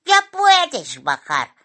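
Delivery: high, loud, unhurried bird voice; magpie character. A narrator's reading. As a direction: call it out slowly to a passenger. Magpie lands, addresses Simón.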